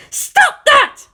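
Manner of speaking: very high, loud scream. A narrator's reading. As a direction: scream it at the boy. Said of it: "she screamed again".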